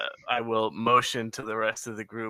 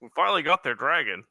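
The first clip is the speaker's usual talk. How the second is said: quick, higher goblin repeat; 5 semitones higher, 5 dB louder, much faster than usual. Acted. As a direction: toss it off as the goblin again. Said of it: "I just shrug and I repeat".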